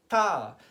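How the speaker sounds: Ta said with the tongue between the front teeth; a crisp, loud Ta, tongue between the teeth. Shown as a demonstration. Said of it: "putting your tongue between your two front teeth like this".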